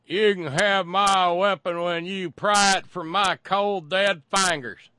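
loud, high, slow defiant drawl; defiant gun owner. Retold or imitated. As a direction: bellow it like a stubborn gun owner. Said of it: "in true N R A form, Edgar says".

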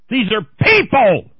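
slow, high, loud outraged shout; screaming. Real, not acted. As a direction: roar it out with full force. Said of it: "we start screaming".

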